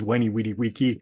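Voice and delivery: Caesar's "Weni Weedy Wiki" with W for V; classical Latin pronunciation. Pretend. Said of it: "Caesar comes out and says".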